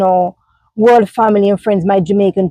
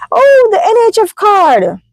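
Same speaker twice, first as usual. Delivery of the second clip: high, mocking chirp; mocking critics; 12 semitones higher than usual. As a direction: mimic the scoffing critic. Imitating critics from back home.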